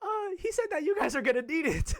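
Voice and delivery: high, loud mock teacher voice; hammed-up high-pitched tattling. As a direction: imitate a teacher nervously passing on bad news. Voices teacher passing on his words.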